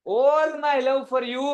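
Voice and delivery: very slow, lifted, drawn out; slow dramatic reading. Shown as a demonstration. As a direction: stretch every word like a heartfelt plea. Teacher reads Devyani's line.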